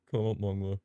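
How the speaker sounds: ordinary talk, nothing marked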